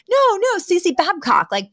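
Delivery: put-on high, excited gushing of an old acquaintance; eager recognition. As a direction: squeal it like you've spotted an old friend. Sex worker insists she knows Cece.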